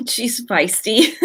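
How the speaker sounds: laughing it out slowly, amused; laughing about her feisty daughter. Real, not acted. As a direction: chuckle through the words.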